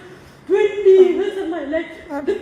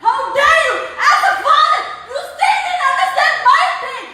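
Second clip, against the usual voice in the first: angry outburst; 8 semitones higher, slower than usual. Real, not acted. Daughter confronting her father about her pain, angry raised voice. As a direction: throw it at him, pitched up in outrage.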